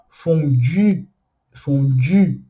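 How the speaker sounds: fondue slowly with rounded French u. Shown as a demonstration. Teacher slowly repeats fondue for students. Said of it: "Então".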